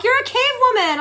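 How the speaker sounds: high, bright quoted voice; chirpy congratulation voice; mock email voice. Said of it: "They're like".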